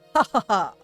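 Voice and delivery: usual speaking voice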